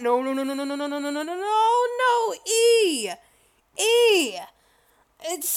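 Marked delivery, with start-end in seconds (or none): 0.0-4.4 string of no's climbing higher and louder; acting out rising alarm at each key; mock panic. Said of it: "I'm just like".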